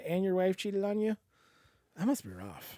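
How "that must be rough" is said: soft, low consoling voice; hushed, low, acted condolence; sincere sympathy. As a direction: offer quiet sympathy after bad news. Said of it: "you can say that legitimately".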